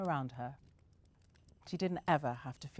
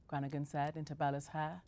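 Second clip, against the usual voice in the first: ordinary delivery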